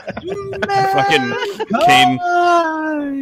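high loud laughing shout. Real, not acted. Laughing outburst at joke.

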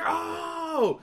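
long, high-pitched loud "oh"; re-enacted cry of excitement. Gambler reacting to the winning roll, re-enacted excited cry. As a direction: let out a drawn-out mock cheer.

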